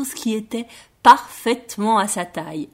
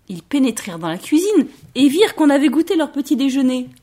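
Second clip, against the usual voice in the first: usual speaking voice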